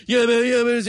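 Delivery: loud high dude voice; high loud bro voice. Retold or imitated. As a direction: squeal it like a hyped bro. Imitating the producer.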